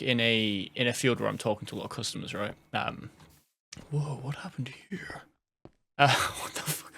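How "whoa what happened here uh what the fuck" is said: surprised whisper. Real, not acted. Surprised by something in game.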